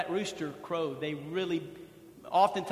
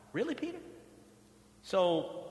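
ordinary talk

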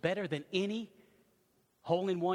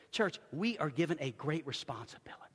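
whispered, intimate charge; 3 semitones lower, 5 dB quieter than usual. Real, not acted.

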